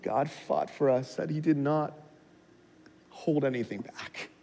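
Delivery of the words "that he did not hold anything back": usual speaking voice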